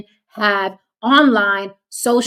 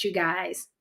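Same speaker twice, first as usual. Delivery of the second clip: usual speaking voice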